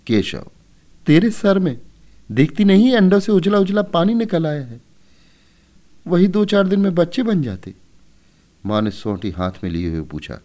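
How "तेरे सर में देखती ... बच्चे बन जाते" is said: put-on higher, louder snappish boy voice; exasperated boy. A narrator's reading. Keshav snaps at his sister over the broken eggs, exasperated. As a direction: brush off your little sister impatiently.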